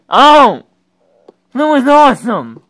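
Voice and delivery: loud, high burst of delight; excited shout. Real, not acted. Player cheering an awesome move on screen, excited shout. Thrilled by the game.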